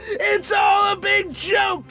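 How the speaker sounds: high, drawn-out crazed cry; manic outcry. Acted. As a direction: wail it in mad hilarity. Madman ranting that life is absurd.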